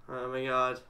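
very soft, low murmur fading to a whisper; under your breath, barely voiced by the end; hushed dread. Real, not acted. Player creeping up on a page in horror game, hushed dread.

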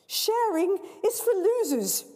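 put-on high, slow sneering girl; spoilt girl. Girl to the MC, high slow sneer. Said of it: "says the girl".

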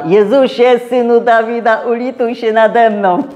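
loud high plea for mercy; crying out. Acted. Blind man calling to Jesus, loud pleading cry. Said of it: "jak jakiś ktoś woła".

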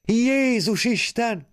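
high, slow shocked cry, half laughing, half sobbing; startled cry. Acted. Woman startled by the sprinklers, crying out high and slow. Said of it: "sírta el magát nevetve".

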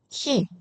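키 short and high with a puff; aspirated 키. Shown as a demonstration. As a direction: release the k of 키 with air. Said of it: "이렇게 읽으시고요". (거센소리로 짧고 높게 키)

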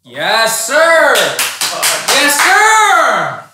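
loud, high yes sir chant; excited cheer. Real, not acted. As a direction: cheer it like a victory. Cheering a win.

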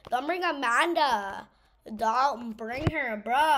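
loud pleading, dragging it out; panicked plea. Real, not acted. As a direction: beg loudly in panic. Panicked pleading during chase.

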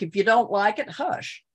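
hint of a stern country mother; country Texas parent. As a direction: hush them like a Texas mom. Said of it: "it was like".

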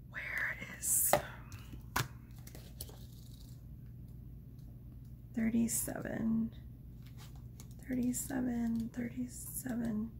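hushed whisper to herself while searching; soft searching whisper. Real, not acted. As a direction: whisper the number under your breath as you search.

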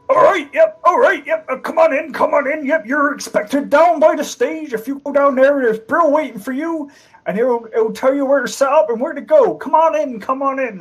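high, loud character voice; bandit guard. Acted. Bandit guard calls the arriving entertainers in, high and loud.

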